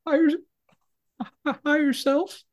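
high, whimpering imitation of sobbing; mock crying. Shot prisoner answering his interrogator, mock crying, very high. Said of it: "And the guy crying goes".

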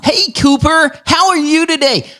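put-on high-pitched California accent; American accent. Australian greets Cooper from California, American accent. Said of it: "That sounded like an American to me".

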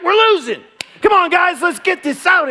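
put-on high, loud kid chatter to teammates; pep up the team like an eager boy; cheering little leaguer. Right fielder rallying his teammates, loud eager kid cheer.

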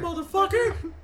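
very high, loud holler; mock outraged yell. Friend joining in the blocked driver bit, high loud mock yell.

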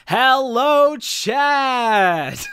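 loud, high, stretched-out streamer greeting; Cosmic Emerald. Acted. Friend impersonates a streamer greeting chat, loud high impression. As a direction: belt out a hammed-up stream intro. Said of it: "This is my best Cosmic Emerald impression".

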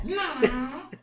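high puppet voice; drawn-out high dummy refusal; Kleber. Acted. Puppet insisting he dislikes them, high puppet voice. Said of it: "acha uma voz que pareça com o boneco".

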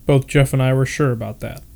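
ordinary delivery

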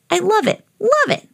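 high-pitched joyful squeal of words; giddy storybook glee; gleeful delight. Acted. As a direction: revel in it like a delighted puppy. Dog delights in the water.

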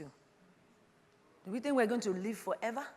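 ordinary talk

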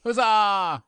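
loud hearty huzzah; cheer. Acted. Lone union member cheering the new charter loudly.

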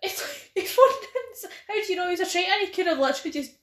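high, laughing disbelief; laughing protest. Real, not acted.

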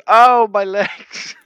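drawn-out, high, loud wail of pain; mock anguished cry. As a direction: ham up a man crying about his legs. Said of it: "when he goes".

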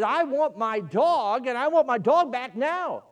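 a belligerent man demanding his dog back. A narrator's reading. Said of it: "a little bit more belligerent".

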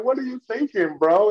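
high, hammed-up disbelief; lightly voiced incredulous friend; mock exasperated question. Friend questioning the car owner, mock exasperation. Acting out the car argument scene.

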